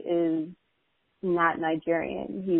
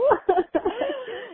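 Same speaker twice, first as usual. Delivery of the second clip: high bright laugh. Real, not acted. Guest laughs at the introduction.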